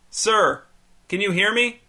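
high call toward the door. A narrator's reading. Calling up the stairs to the neighbor, high call. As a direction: call up, straining to be heard.

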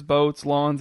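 ordinary delivery

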